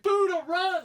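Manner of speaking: high, very loud shout reliving the moment; retold scream. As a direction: yell a frantic warning at the TV. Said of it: "I'm screaming".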